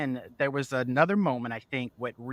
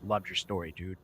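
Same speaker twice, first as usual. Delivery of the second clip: low, hushed imitation of a bully; quietly imitated tough kid; retold whisper; 5 semitones lower, 5 dB quieter than usual. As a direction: say it low and confidential, half whispering. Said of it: "he just whispered".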